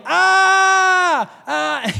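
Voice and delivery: loud, high imitated wail; long, drawn-out mock cry, easing on the second. Imitating boy stuck in a storm drain, wailing. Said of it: "just wailing".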